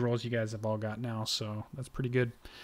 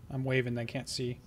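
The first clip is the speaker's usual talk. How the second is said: ordinary talk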